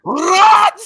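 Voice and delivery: very high, loud shout; yelled what. Retold or imitated. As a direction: shriek it sharply. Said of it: "And then it's".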